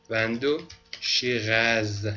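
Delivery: وندو شیراز said slowly, with a French r; slow French phrase. Shown as a demonstration. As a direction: drawl out وندو شیراز for the class. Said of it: "مثلاً وندوشی غاز". (وندو شیراز را آهسته و فرانسوی بگو)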